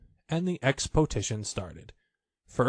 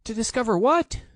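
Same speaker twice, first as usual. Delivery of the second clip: anxious; 12 semitones higher, 5 dB louder than usual. A narrator's reading. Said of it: "said Piglet anxiously".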